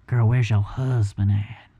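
slow, loud, low-pitched smooth come-on; drawn-out, silky pickup line; smooth flirting. Pretend. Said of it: "I wish it sounded that smooth".

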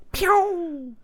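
high, loud ricochet pew; bullet ricochet. Shown as a demonstration. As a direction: zip it off like a bullet. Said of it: "ricochet sound".

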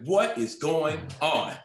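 slow, high and drawn-out disbelief; amazed. Retold or imitated. Landing on a [name] set from the airport, amazed and slow. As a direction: stretch each word, overwhelmed.

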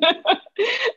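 high, uneasy burst of laughter; nervous laugh. Real, not acted. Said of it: "I shouldn't be laughing".

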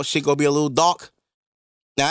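normal talking voice

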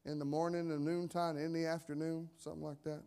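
usual speaking voice

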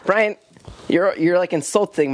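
high, sharp outraged protest; indignant. Real, not acted. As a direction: defend your dad, outraged. Protesting insult to dad.

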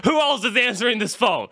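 high, laughing sarcastic jab; laughing at a friend who called; laughing retort. Retold or imitated. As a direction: laugh it out at the silly question.

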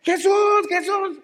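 high-pitched, loud calling; desperate shout. Acted. A blind man desperate to see calls Jesús, desperate shout. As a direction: cry out blindly for help. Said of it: "¿Cómo grita un ciego desesperado".

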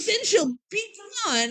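high, mock scheming villain; lightly voiced, smug cartoon villain; villain's plan. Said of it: "He's like".

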